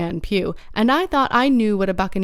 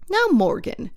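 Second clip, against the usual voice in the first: stern, higher warning tone; 5 semitones higher than usual. A narrator's reading. Long John confronting sheepish Morgan, stern. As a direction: call him out sternly. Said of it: "said Long John very sternly".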